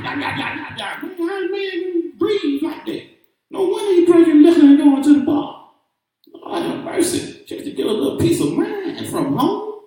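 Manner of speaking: high sing-song mocking woman; hammed-up high woman's voice. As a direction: parody a woman scolding men. Mimicking a woman's take on men.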